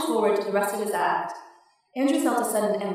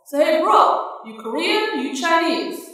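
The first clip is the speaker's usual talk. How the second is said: booming, high, loud frat bro drawl; John Wayne as frat boy; 7 semitones higher, 4 dB louder, slower than usual. A narrator's reading. Comic to the audience, booming John Wayne frat boy. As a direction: boom it out like a fake cowboy frat boy. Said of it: "in a John Wayne as frat boy voice".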